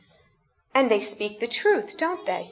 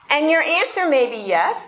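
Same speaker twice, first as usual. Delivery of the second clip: normal talking voice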